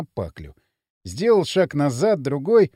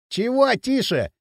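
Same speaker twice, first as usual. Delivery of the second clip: high, slow, cocky retort; loose, insolent drawl; cheeky and brash; 6 semitones higher, slower than usual. A narrator's reading. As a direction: toss it back without a care. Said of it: "развязно сказал Пакля".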